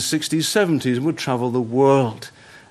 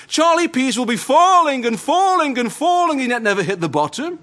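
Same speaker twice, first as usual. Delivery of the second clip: much higher, louder rising terror; anguished pleading; 11 semitones higher, 4 dB louder than usual. Retold or imitated. Condemned man begging the chaplain, anguished terror. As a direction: plead in mounting horror.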